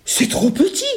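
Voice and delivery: put-on high child's objection; protesting little girl. Little girl at the keyhole, high protesting voice.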